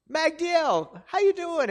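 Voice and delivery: high, bright greeting lifted well up; delighted surprise at seeing him; glad greeting. Retold or imitated. Pastor greeting an old friend on the street, delighted.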